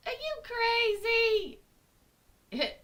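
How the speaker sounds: high, loud incredulous cry; outraged inner voice. Acted. Logical brain scolding her, high loud mock outrage. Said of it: "very small part of me that has a logical brain".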